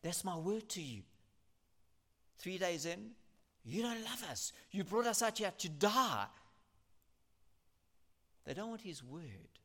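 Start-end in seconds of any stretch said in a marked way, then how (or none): 3.6-6.3 complaining Israelites. Acted. Israelites complain three days in.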